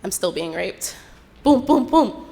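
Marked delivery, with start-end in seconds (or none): 1.4-2.3 loud, high, hammering booms; forceful mimicked door knock; imitated pounding. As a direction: bang out each boom like a fist on wood.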